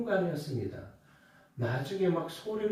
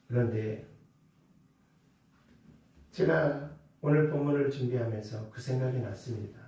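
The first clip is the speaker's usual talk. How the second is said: ordinary delivery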